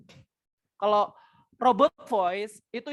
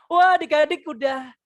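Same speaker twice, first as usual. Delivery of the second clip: put-on expressive, for children; 5 semitones higher than usual. Shown as a demonstration. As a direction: greet small children brightly and expressively. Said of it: "Ini kan harus ekspresif". (ekspresif menyapa anak-anak)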